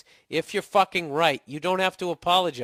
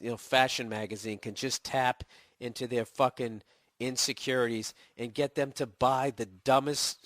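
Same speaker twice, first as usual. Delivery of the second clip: ordinary talk, nothing marked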